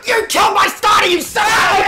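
yelling high and loud at the screen; outraged, blasting it at the game; furious shout. Real, not acted. Gamer shouting at the enemy who killed his starter, furious yell.

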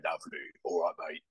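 a hint of Phil Mitchell's Cockney growl; quiet understated Cockney geezer; gruff Cockney. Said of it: "he kinda talks like this".

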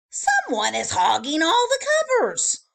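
loud, high, indignant guest; indignant complaint. Acted.

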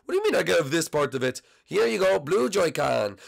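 ordinary talk, nothing marked

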